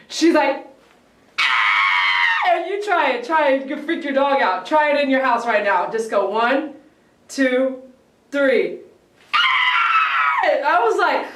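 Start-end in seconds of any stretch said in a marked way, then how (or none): 1.4-2.5 shouted scream in a changed voice; imitated dog scream. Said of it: "at the highest pitch of her voice". 9.3-10.4 piercing high shouted scream, much higher; imitated dog scream. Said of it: "she screams".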